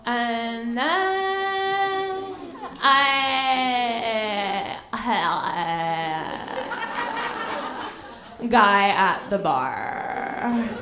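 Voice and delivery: very slow drawl melting into mere sounds; wordless Hollywood-girl drone. Acted. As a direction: stretch keywords until they barely sound like words. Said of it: "it doesn't even sound like words anymore".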